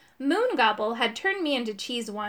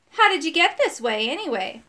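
needling question, starting high and loud; put-on mean edge; mildly mean; 4 semitones higher, 5 dB louder than usual. A narrator's reading. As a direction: push the question to needle him. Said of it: "asking them in a mean way".

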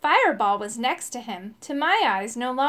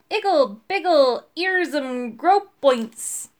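loud, measured magic incantation; punchy storybook wizard's spell; wizard casting a spell; 3 semitones higher, 5 dB louder, slower than usual. A narrator's reading. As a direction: chant it word by word like a spell. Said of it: "waved his wand over me and said".

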